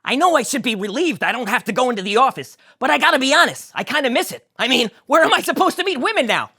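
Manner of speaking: high, loud whiny George; glum George. Acted. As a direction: complain about missing the office.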